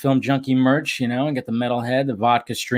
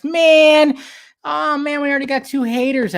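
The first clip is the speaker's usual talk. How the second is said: mock dismay; 13 semitones higher, 4 dB louder than usual. Real, not acted.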